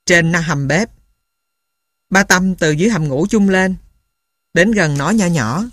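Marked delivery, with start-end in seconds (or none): none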